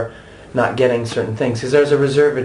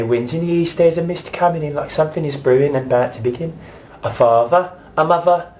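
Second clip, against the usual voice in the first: London stage accent; 5 semitones higher than usual. Shown as a demonstration. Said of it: "in the beginning I say".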